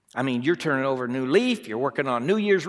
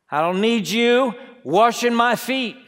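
louder, higher insistence; proud loud refusal; 6 semitones higher, 5 dB louder than usual. Acted. Preacher voicing the proud heart refusing Jesus, rising loud. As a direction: push Jesus away with pride.

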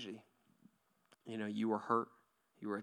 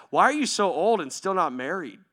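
imitating a youth kid; 8 semitones higher, 18 dB louder than usual. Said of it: "He was just like".

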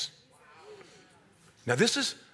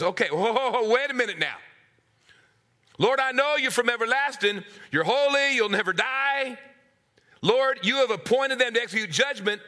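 higher, louder protesting prophet; prophet's complaint; 7 semitones higher, 4 dB louder than usual. Acted. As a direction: argue with God, loud and pleading.

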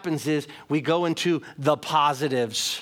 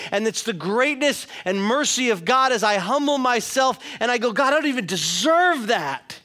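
higher, driving sermon delivery; fervent preaching; 6 semitones higher, 3 dB louder than usual. Real, not acted. Preacher telling the congregation salvation covers everything, fervent. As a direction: build the list emphatically, hitting each key word.

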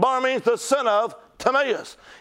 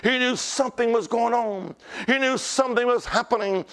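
normal talking voice

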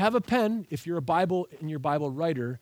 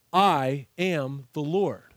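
slow weighty refrain; 2 semitones lower, 3 dB louder, much slower than usual. Shown as a demonstration. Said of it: "these sentences all end the same way".

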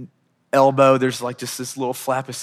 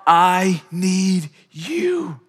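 sincere prayer; 5 semitones higher, 7 dB louder, much slower than usual. Shown as a demonstration.

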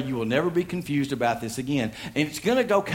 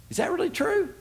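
put-on very high, wide-eyed child voice; curious kid voice. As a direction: ask it like an innocent kid. Tommy's question acted.

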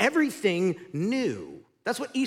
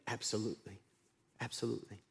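dropped low and much softer; low emphatic; 10 semitones lower, 15 dB quieter, faster than usual. Acted. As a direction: answer in a quiet, certain low voice.

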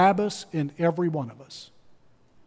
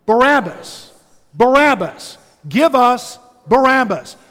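higher, louder chant of the crowd; preacher playing the mob demanding Barabbas, shouting; 5 semitones higher, 6 dB louder than usual. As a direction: roar it like an angry mob. Retelling the crowd scene.

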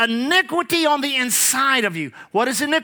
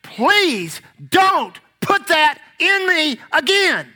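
high, slow, emphatic mock plea; pleading body; 5 semitones higher, 3 dB louder, slower than usual. Smoker's body begging the smoker, acted pleading. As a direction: beg hard, word by word. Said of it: "you know what it was saying".

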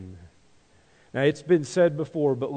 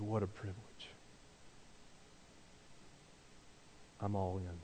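soft, low, earnest devotion; hushed reverence; 7 semitones lower, 19 dB quieter than usual. Retold or imitated. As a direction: speak it as a quiet vow. Jesus's willing words, reverent.

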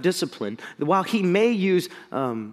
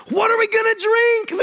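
much higher, a mock-indignant demand; whiny demand; 3 dB louder than usual. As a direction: demand it like a grumbling crowd. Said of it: "they demanded".

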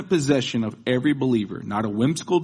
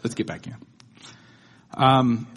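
usual speaking voice

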